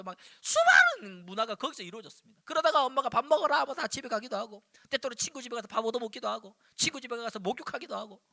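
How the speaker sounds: usual speaking voice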